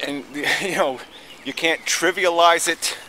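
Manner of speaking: usual speaking voice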